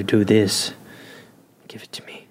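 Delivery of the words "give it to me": seductive whisper. Pretend.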